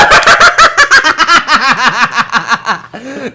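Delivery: shrieking high laugh; cackling laugh; loud laugh. Real, not acted. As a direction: burst out laughing. Big laugh at the joke.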